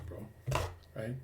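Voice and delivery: talking around a cigarette; cigarette in mouth. Real, not acted. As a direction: speak with a lit cigarette in your lips. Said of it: "let me light a cigarette".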